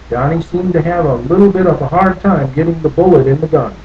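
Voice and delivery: plain reading voice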